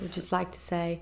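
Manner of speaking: put-on soft, botched English accent; quietly retry the Queen's English accent; badly faked English accent under the breath; attempted Queen's English. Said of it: "I don't know what the Queen's English is".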